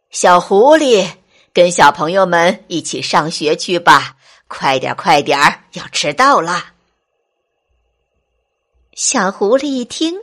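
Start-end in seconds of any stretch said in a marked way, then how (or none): 0.1-6.7 low, loud goat-teacher shout; loud calling out. Acted. Goat teacher shouting to little fox, loud hurrying call. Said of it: "山羊老师大声地喊道".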